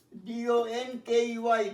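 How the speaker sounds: usual speaking voice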